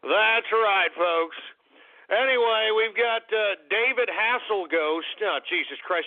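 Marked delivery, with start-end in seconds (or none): none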